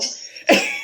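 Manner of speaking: a loud squeal of laughter; loud high laugh. Real, not acted. Guest laughing at her own alpha joke, loud high laugh.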